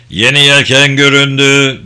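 loud, sustained call from the masthead; lookout's call. A narrator's reading. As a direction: call out a sighting to the whole deck. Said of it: "diye geldi bir ses ön direğin tepesinden".

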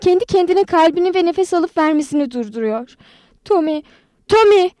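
high alarm building to a loud call; panicked shouting. A narrator's reading. Anita calling Tommy to the stricken girl, panicked shouting. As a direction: shout for help, the last name loudest. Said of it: "diye bağırdı Anita".

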